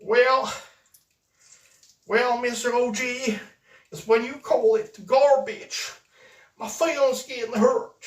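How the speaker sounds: high, loud, whiny mock offense; mock hurt. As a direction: pretend your feelings are hurt. Mock hurt by garbage remark.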